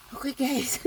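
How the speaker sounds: breathless, winded from running; puffing between words; out of breath. Real, not acted. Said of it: "hinihingal ako".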